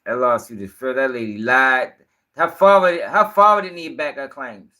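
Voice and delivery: ordinary talk, nothing marked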